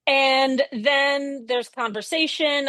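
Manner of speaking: ordinary talk, nothing marked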